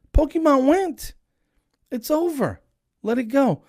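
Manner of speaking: very high, loud mock disbelief; incredulous rant. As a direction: squeal it out like you can't believe it. Acted incredulous rant at the lady.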